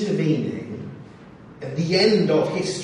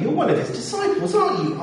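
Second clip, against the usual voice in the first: much higher, sharp accusing question; shrill accuser; 12 semitones higher than usual. Acted. Second accuser confronting Peter, much higher and insistent. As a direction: press harder, sure of the answer now.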